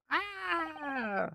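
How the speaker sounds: high, drawn-out scream; high-pitched scream. Acted. Heard from inside the cabin, a high-pitched scream. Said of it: "Such a high-pitched scream".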